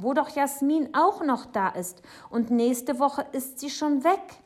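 high, loud, urgent persuasion; pleading. Acted. Boy persuading Papa before his friend leaves, pleading. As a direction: press your case like a pleading child.

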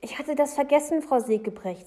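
quick, loud cut-in; hasty interruption. A narrator's reading. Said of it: "sagt Jonathan schnell".

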